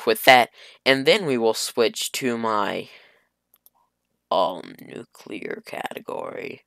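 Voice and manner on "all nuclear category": whisper. Real, not acted. Gamer whispers category name.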